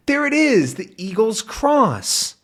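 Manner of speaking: high, drawn-out reveal; a touch of triumph; excited reveal. Acted. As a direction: point to the find. Discovery moment.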